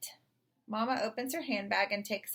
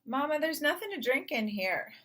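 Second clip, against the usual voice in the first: calling sister; 6 semitones higher than usual. Acted. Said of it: "calling from behind the refrigerator door".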